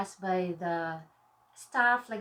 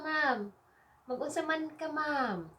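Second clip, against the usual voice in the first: lightly voiced staff greeting, higher pitch; atendente perguntando ao cliente; 6 semitones higher than usual. Shown as a demonstration. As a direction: ask as a service clerk would, pitched a little up. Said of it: "So they will ask you this".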